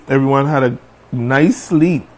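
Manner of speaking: ordinary talk, nothing marked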